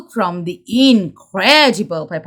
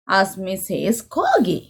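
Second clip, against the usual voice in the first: usual speaking voice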